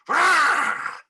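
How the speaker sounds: high loud roar, like a startled bear. A narrator's reading. Bear roaring as his fur is tugged, startled. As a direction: let out a big roar. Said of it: "Old Bear roared".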